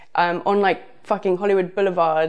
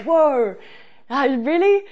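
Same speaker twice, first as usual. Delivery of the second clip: surprised; 8 semitones higher, slower than usual. Real, not acted. Unexpected invitation home.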